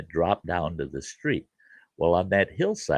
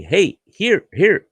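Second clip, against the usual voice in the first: high, loud eager hey here; handing over money; 11 semitones higher, 7 dB louder, slower than usual. Retold or imitated. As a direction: push the money at the kid. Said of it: "And he'd tell me".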